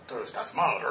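jokey mean scolding, pitched low; hammed-up harsh rebuke; mock meanness. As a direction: play the nasty accuser, breaking into a laugh. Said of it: "No malo, como".

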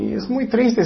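ordinary talk, nothing marked

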